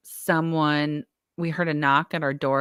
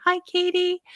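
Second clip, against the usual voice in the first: cheerful greeting. Real, not acted. Greeting a live viewer.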